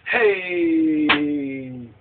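long, low movie-style "hey"; drawn-out exclamation. Acted. Imitating an angry African movie character, drawn-out exclamation. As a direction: stretch the call out, falling slowly. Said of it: "he did one of those numbers".

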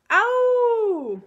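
very high, loud yelp; pained shriek. A narrator's reading. Giant with Sofie in his ear, shrieking in pain. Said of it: "zaječel Obrdobr".

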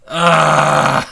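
loud exasperated groan into a laugh; real fed-up groan. Real, not acted. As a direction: let out a frustrated ugh.

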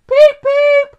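very high, loud engine beeps; piped-up beep beep; whistle beeps. A narrator's reading. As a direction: imitate a small engine's whistle. Edward whistles he is ready.

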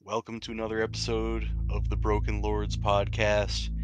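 normal talking voice

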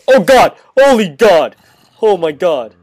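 loud startled cries of fright; panicked scream. Real, not acted. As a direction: yell in sudden panic. Startled by sudden danger.

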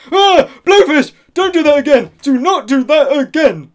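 high, loud panicked yelling; startled shout. Acted. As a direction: shriek it in fright.

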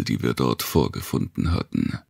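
usual speaking voice

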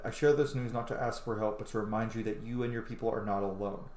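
ordinary talk

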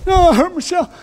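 put-on high childish whimper; mock crying toddler voice; whiny baby. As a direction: play a hurt little child. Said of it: "Natural for a baby to say".

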